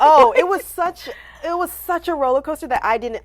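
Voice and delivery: high, loud, overwhelmed exclaim. Real, not acted. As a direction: gush it out, still amazed. Recalling overwhelming surprise.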